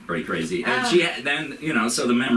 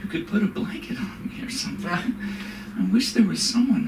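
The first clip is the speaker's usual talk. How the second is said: put-on high, plaintive old-lady whine; whiny old mother; 12 semitones higher than usual.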